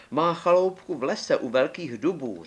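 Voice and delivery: put-on the girl telling where grandma lives; little girl's voice. A narrator's reading. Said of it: "řekla Karkulka".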